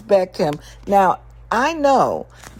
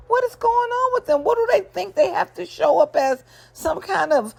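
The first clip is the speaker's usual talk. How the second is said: pitched way up in disbelief; baffled high exclamation; 12 semitones higher than usual. Real, not acted. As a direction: squeal out how absurd it is. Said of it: "host baffled by couples".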